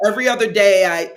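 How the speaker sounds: usual speaking voice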